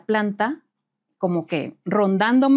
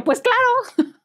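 indignant retort; 14 semitones higher, much slower than usual. Retold or imitated.

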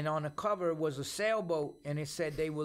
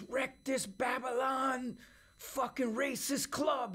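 much higher, slow, fierce battle cry from the stage; rallying cry; 7 semitones higher, slower than usual. Retold or imitated. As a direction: rouse the crowd like a militant preacher. Singer rousing the crowd on stage.

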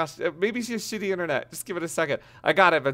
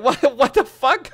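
ordinary delivery

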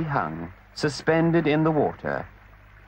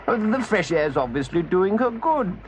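gentleman voice; 7 semitones higher than usual. Acted.

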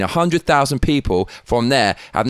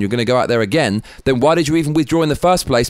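usual speaking voice

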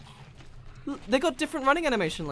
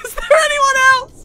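high, loud laughing echo; laughing shout; 11 dB louder than usual. Real, not acted.

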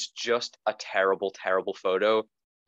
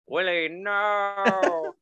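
put-on very high, very slow old Western wail; Old West accent; 11 semitones higher, much slower than usual. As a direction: drag it out like a frontier father. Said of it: "it's like".

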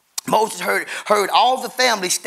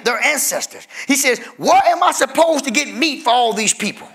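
higher and louder, voicing Moses' desperate complaint; full-force preaching; 8 semitones higher, 3 dB louder than usual. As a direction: preach it out, crying Moses' plea to God.

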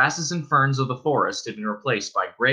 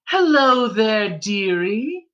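put-on higher, slow, sweet old-woman call; welcoming innkeeper's wife; 9 semitones higher, 3 dB louder, much slower than usual. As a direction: call out kindly to the stranger in the rain. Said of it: "called a welcoming voice".

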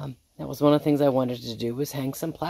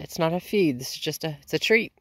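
usual speaking voice

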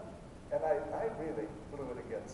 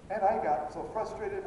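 ordinary talk

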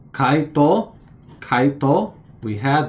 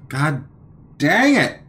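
higher, louder exasperated god dang it; frustrated outburst; 4 semitones higher, 3 dB louder than usual. Real, not acted. Learner forgets a vocab word again, frustrated outburst. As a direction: blow up at yourself for forgetting.

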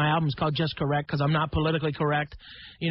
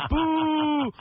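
crowd booing; 10 semitones higher, 4 dB louder than usual. Retold or imitated.

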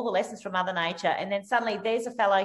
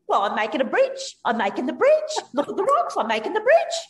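excited stranger; 7 semitones higher, 5 dB louder than usual. Retold or imitated. Quoting excited stranger Shane.

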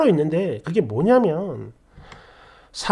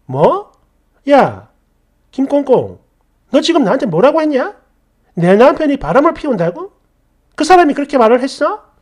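loud, indignant demand, fast; angry confrontation; 4 semitones higher, 5 dB louder than usual. Retold or imitated. Wife confronts friend over cheating claim, loud angry demand. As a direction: snap at your friend in outrage. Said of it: "웃음기 싹 가시고 정색을 하며".

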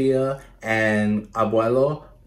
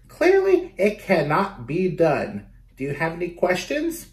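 prim clerk; 7 semitones higher than usual. Acted. Clerk voiced in a story.

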